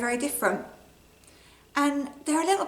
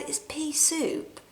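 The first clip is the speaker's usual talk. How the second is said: gentle correction; 4 semitones higher, 8 dB quieter, much slower than usual. Acted.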